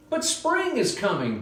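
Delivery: high, chirping bird voice; chirpy bird. Acted. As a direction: chirp it like a cheerful little bird. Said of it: "Bird chirped".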